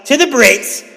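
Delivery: theatrical British offer; bad British accent. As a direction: proclaim it in a hammed-up British accent. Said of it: "that's the best British accent".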